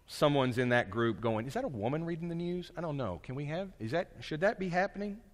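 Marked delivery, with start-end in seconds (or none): 1.5-5.2 soft, fast, unsure muttering; a listener half-whispering doubts; quiet doubting voice. Retold or imitated.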